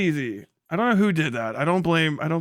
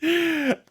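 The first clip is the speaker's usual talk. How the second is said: high laugh; 14 semitones higher than usual. Real, not acted. Laughing at the clip.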